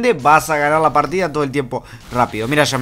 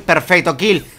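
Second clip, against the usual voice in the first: ordinary delivery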